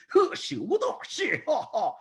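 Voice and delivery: high, slow kung fu exclamations; Bruce Lee movie imitation. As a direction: shout like a martial arts film. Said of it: "like Bruce Lee movies".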